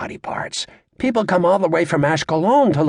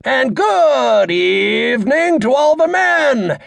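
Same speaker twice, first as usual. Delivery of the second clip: big, slow, much higher and louder showman's hello; booming stage greeting; 10 semitones higher, 5 dB louder, much slower than usual. Acted. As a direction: boom it out like a comic opening his set. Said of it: "He charges ahead".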